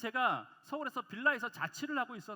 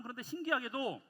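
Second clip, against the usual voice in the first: ordinary talk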